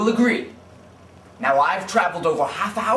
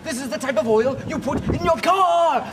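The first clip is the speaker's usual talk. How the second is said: higher, indignant scolding of a dumb customer; mock outraged yelling; 6 semitones higher than usual. Oilman scolding a customer who wants to cook with petroleum, outraged yelling. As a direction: berate him like he's an idiot. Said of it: "Please don't yell at me".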